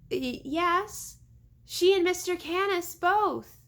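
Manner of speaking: put-on a small girl teasing her sister; little girl voice. A narrator's reading. Little girl answering Sabrina, in a high childlike voice. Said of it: "Daphne said".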